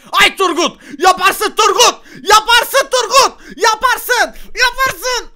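very high, loud screamed encouragement; frantic self-cheering. Real, not acted. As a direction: scream yourself on like a fan. Kendine tezahürat yapıyor. (tiz sesle çığlık çığlığa tezahürat)

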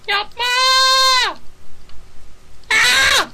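very high, loud squeal; little-girl scream. Pretend. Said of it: "Küçük bir kız gibi bağırırım".

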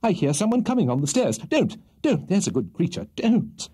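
high, urgent pleading; flustered gentleman, voice pitched right up; struggling alarm. A narrator's reading. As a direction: beg her in a panic to let go. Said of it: "struggling violently".

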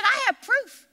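ordinary talk, nothing marked

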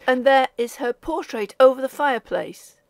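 put-on young man calling across an empty haunted room; ringing shout. A narrator's reading. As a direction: call it out so it echoes. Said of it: "he shouted in his ringing voice".

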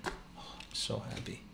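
slow, breathless hushed whisper. Real, not acted. Dad keeping quiet for his sleeping daughter, happy whisper. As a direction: whisper your delight under your breath. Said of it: "I have to be quiet 'cause my daughter's sleeping".

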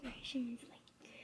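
whispering. Real, not acted. Child whispers answer.

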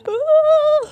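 very high, loud ooh; surprised squeal. Real, not acted. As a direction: squeal in shock. Reacting to the filtered eyes.

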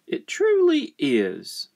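high, slow wifely agreement; high-pitched character wife; farmer's wife. Acted. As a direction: agree in a high, drawn-out wife's voice. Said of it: "said his wife".

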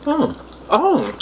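high, slow disgusted "Oh my God"; disgusted groan. Real, not acted. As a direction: recoil from a foul taste. Reacting to disgusting candy taste.

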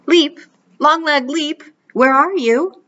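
high, loud frightened call; fearful calling. A narrator's reading. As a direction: call out in fear. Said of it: "she calls fearfully".

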